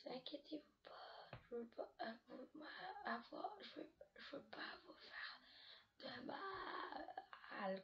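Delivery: un clown souffrant, à peine chuchoté; voix de clown blessé. Acted. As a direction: murmure plaintif et inquiétant. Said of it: "avec une voix comme s'il y avait mal". (léger clown blessé, chuchoté et plaintif)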